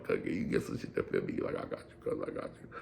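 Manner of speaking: asking the homie quietly for clothes that fit, whispered. Retold or imitated. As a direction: whisper it secretly. Secret request and promise.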